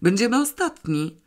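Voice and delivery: irritated reminder, voice lifted; angry reminder. A narrator's reading. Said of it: "przypomniał gniewnie krytyk".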